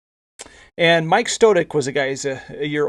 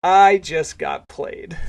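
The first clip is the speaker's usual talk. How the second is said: very slow, louder stunned repeat; retold astonishment, drawn out and emphatic; dumbstruck disbelief; 3 semitones higher, 5 dB louder, much slower than usual. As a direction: stretch out the I in amazed realization. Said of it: "Chris is just dumbstruck".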